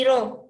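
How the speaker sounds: "ro" falling away after the stressed syllable; unstressed, descending "ro"; voice going down. Shown as a demonstration. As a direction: let the pitch drop through "ro". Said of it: "voy bajando".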